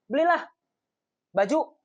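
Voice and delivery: high, loud bossy command; harsh order. Shown as a demonstration. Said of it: "Mana yang lebih enak".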